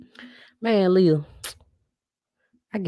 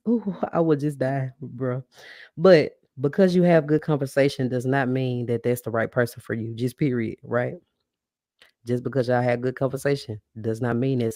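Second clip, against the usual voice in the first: normal talking voice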